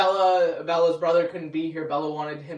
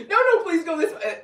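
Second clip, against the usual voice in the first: much higher, laughing plea; pleading; 11 semitones higher, 5 dB louder than usual. Retold or imitated. Begging the taxi driver to take the right turn, high and laughing. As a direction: beg the driver, half laughing.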